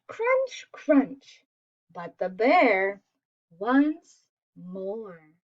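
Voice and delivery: ordinary delivery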